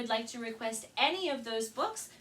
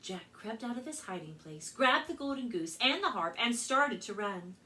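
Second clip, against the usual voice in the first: lively read-aloud storytelling voice; story narration; 2 semitones higher, 4 dB louder than usual. A narrator's reading. Librarian reading Jack and the Beanstalk to children, storytelling.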